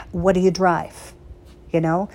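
ordinary talk

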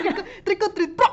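high, laughing mock call of trick or treat. Real, not acted. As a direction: laugh it out as you echo her.

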